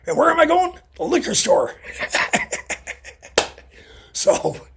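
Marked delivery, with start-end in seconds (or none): 1.8-3.1 sudden high breathy cackle. Real, not acted. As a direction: burst into a squeaky laugh at your own joke. Joke about the liquor store.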